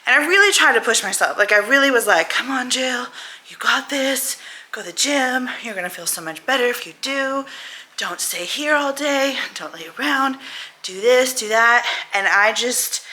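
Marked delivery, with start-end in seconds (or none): 2.3-11.9 lightly voiced pep talk to herself; self pep talk. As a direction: coax yourself off the couch, a little higher. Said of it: "I would give myself the pep talk".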